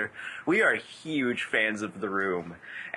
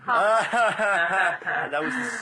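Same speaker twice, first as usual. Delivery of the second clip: drawn-out, laughing, much higher; laughing groan; 8 semitones higher, 4 dB louder, much slower than usual. Real, not acted. Cohost laughs at a terrible pun, groaning. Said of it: "groaning at a stupid pun".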